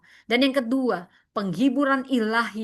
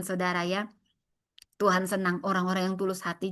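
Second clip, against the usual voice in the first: ordinary delivery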